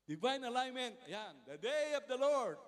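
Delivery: luid en hoog, langzaam verkondigd; preaching proclamation. Acted. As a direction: roep de titel uit als een prediker. Preaching slide titles.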